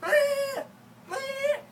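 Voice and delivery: very high mock baby bleat; baby whine. Mimicking the baby brother's crying, high baby bleat. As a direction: squeak it like a crying infant. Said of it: "He's like".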